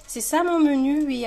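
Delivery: high, loud proud reveal; excited announcement. Real, not acted. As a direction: present it with a flourish.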